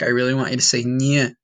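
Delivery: normal talking voice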